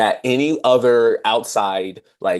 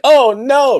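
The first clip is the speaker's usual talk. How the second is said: dismayed gasp; 9 dB louder than usual. Retold or imitated. Acted reaction to misread.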